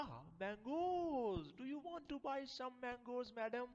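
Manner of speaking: high, drawn-out delighted cry; light up at the sight of mangoes; delighted servant. Acted. Servant thrilled by the mangoes asks madam.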